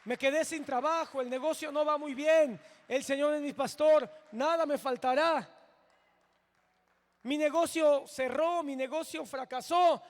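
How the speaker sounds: loud, high fervent preaching; preacher rallying a struggling congregation, loud and fervent; preaching at full force. Acted. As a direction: proclaim it like a revival preacher, loud and driving.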